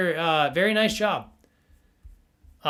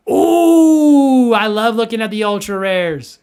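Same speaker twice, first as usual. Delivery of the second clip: excited ooh; 5 semitones higher, 11 dB louder, slower than usual. Real, not acted. Excited gasp at rare art cards.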